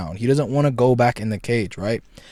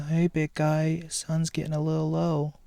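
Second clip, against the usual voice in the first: soft, higher, seductive purr; 4 semitones higher, 6 dB quieter than usual. Retold or imitated. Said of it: "she's, you know".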